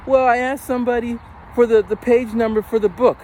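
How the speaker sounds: mock-innocent schoolkid voice; excuse-making kid. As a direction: plead like a pupil caught out.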